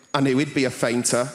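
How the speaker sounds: low, loud woman's bellow, lightly imitated; mock bellow from an unimpressed onlooker; bellowing Linda. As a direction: boom it out low across the room. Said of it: "Linda's bellowing voice just going".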